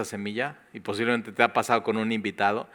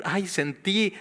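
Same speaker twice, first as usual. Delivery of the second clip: higher mock swoon; swooning newcomer; 7 semitones higher, slower than usual. Newcomer moved by the drums, mock swoon. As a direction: sigh it like someone overcome by the music.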